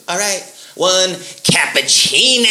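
slow, sing-song barista call; mock barista call-out. Barista calling his cappuccino order, slow sing-song. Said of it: "she goes".

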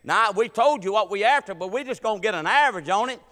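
loud comic telling-off; mock scolding. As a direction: scold the preacher, playing it up. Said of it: "scolded him".

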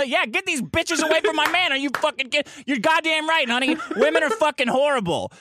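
mock approving wife. Wife cheering on her man's rant, hammed-up indignation.

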